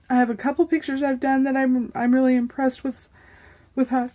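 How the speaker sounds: talking through sudden hiccups; words broken by hiccups; hiccuping. Real, not acted. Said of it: "I have the hiccups all of a sudden".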